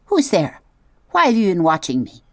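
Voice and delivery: high, quick, alarmed opossum voice; hissing opossum. Acted. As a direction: snap up from the shadows, startled. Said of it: "The creature's voice hissed".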